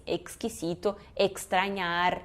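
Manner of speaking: "exquisito" and "extrañar" soft, low, with clear K-S. Shown as a demonstration. Teacher summing up the general rule, clear KS. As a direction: sound "eks" carefully in "extrañar". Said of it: "a regra geral é que é x".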